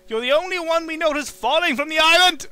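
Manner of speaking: shifty host dodging the question; hidey shifty. Acted. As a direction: sound like he's hiding something. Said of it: "making him sound so, you know, hidey shifty".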